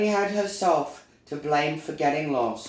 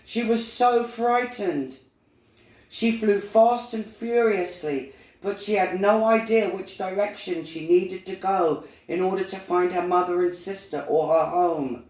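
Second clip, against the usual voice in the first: raised pitch, urgent storytelling; higher, tense narrator voice; alarmed narration; 6 semitones higher than usual. A narrator's reading. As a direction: tell the frightening chase with mounting alarm. Narrating the dog attack.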